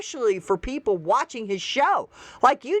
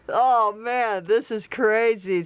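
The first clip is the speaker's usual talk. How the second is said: ordinary delivery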